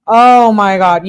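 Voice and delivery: exasperated oh my God. Real, not acted. Reacting to seventeen texts.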